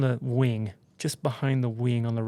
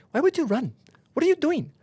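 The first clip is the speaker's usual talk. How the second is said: cute, high-pitched little French voice; Annie; 14 semitones higher, 3 dB louder than usual. Retold or imitated. French friend questioning the man who ran, cute French voice. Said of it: "Annie's little cute little French, French voice".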